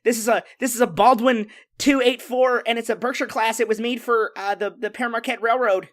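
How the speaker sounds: put-on high, eager know-it-all kid; nerdy kid voice. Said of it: "You have an incredibly good nerdy kid voice".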